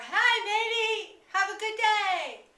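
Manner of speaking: loud, high, drawn-out holler; sing-out shout to someone far off; mountain holler. Shown as a demonstration. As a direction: call out a friendly greeting across the mountains. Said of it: "you're gonna holler across the mountains".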